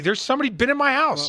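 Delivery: high-pitched, startled disbelief; shocked homeowner. Acted. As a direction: raise the pitch as if just finding an intruder's trace. Voices shocked homeowner finding the card.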